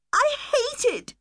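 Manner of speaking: upset daughter protesting the move, high and tearful; crying child. Acted. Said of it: "she cried".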